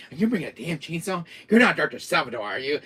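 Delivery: mock panicked rant, shouted at the end; exasperated yell. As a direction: build from disbelief to a furious outburst. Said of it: "I'm like".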